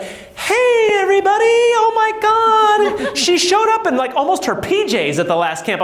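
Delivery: high, bubbly, laughing "hey everybody"; imitating Dory. Coach voices Dory cheerfully.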